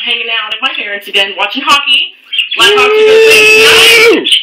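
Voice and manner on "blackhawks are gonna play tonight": loud, high excited yell; excited shout. Real, not acted. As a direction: shout it out with game-night hype. Hockey game night hype.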